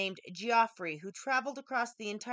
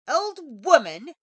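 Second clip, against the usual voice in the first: loud, high angry shout; an offended prince shouting at the hag; gruff prince; 5 semitones higher, 12 dB louder than usual. A narrator's reading. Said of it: "he said in a gruff voice".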